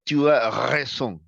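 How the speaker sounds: tu as raison slow, throaty r in raison; French accent, slow; French pronunciation. Shown as a demonstration. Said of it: "en francés sería".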